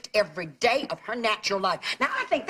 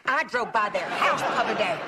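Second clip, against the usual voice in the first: usual speaking voice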